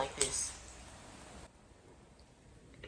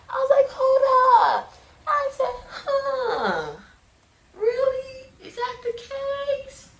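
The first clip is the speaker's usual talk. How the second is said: shocked disbelief; 5 dB louder, much slower than usual. Retold or imitated. Shocked realization watching testimonies.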